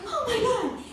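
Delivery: high-pitched mock movie-heroine gasp; imitated actress. Thin action-film actress running down, mock high exclamation. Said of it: "And she's like".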